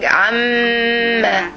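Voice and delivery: "عما" with a long, humming doubled m; shadda on mim. Shown as a demonstration. Teacher explaining the shadda, "عما" with the m held long. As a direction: hold the mim in "عما" before releasing the final vowel. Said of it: "look to the shadda above the mim".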